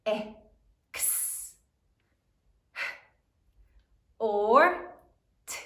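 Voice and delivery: "Ex. Or t." as isolated sounds with pauses; sounding it out. Shown as a demonstration. Teacher sounds out exhort for third grade, sound by sound. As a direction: stretch each sound of "Ex. Or t." separately. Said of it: "Sound it out".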